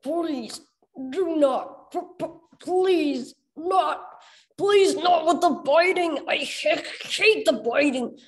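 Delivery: high, slow robot voice stammering in jolts; timid robot begging, slow and high with stutters; stuttering robot. Acted. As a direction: plead like a glitching machine that stutters. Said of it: "The bot's flat voice came in jolts and starts".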